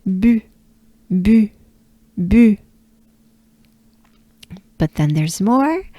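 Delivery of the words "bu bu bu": "bu" with rounded French u; French u sound. Shown as a demonstration. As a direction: drill short "bu", evenly spaced. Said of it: "take a good breath together".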